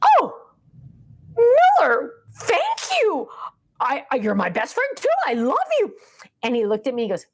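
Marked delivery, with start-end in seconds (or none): 0.0-5.9 put-on high, melting coo to a small child; gushing tenderness. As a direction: re-enact being touched, pitched way up. Retells gushing reply to grandchild.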